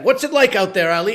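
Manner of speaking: anchor throwing live to the field; news anchor cue; field reporter voice. Pretend. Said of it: "speak in a field reporter voice".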